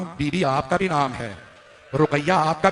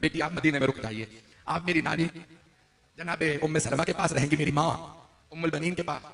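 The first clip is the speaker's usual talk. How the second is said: much softer, lower, gentle father; tender refusal; 3 semitones lower, 10 dB quieter than usual. Acted. Father gently telling his sick daughter to stay, tender and soft. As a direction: soothe the sick child while saying no.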